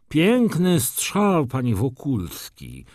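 slow, high, struggling baron; laboured speech. A narrator's reading. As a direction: force the words out with difficulty. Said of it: "mówił z trudnością baron". (mówienie z trudem, wysoko i powoli)

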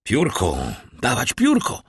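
mild urgent call, pitch jumping up; doctor demanding help at once. A narrator's reading. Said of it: "zawołał doktor". (naglące, niezbyt głośne wołanie)

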